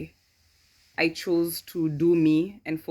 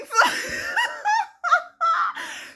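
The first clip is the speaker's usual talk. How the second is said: shrieking peal of laughter; high, helpless, loud cackle; 4 dB louder than usual. Real, not acted. Cracking up over the flies joke, loud high laugh.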